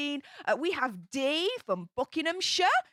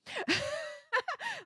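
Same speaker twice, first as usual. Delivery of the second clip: a soft, high embarrassed giggle; quiet squeaky laugh; 13 semitones higher, 6 dB quieter than usual. Real, not acted. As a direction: laugh it off sheepishly. Laughs about big haul.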